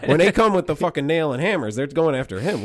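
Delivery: hammed-up panicky bro; excited. Mock panic about the cross.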